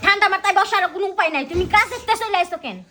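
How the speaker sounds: loud, quick scolding; annoyed scolding. Acted. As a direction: scold him for coming home. Wife scolds husband home early.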